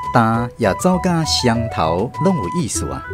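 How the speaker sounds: ordinary delivery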